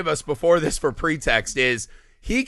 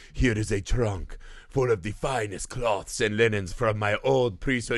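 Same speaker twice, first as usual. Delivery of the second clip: lower, softer, grand god voice; a hammed-up sea god offering gifts; mock deep Dagon; 8 semitones lower, 7 dB quieter than usual. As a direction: intone it like an ancient deity.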